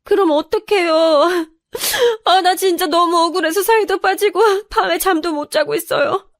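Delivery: high-pitched sobbing complaint; crying, wronged and at the end of her rope; tearful. Acted. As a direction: wail it out through sobs. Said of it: "저는 울면서 사실을 말했더니".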